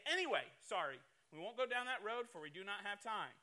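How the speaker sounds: usual speaking voice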